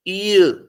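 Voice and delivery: "ईय" gliding from ee into a schwa; diphthong. Shown as a demonstration. Teacher answers own question about the ending, diphthong "ईय". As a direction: draw "ईय" out as one gliding vowel. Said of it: "ये हमारे diphthong होती है".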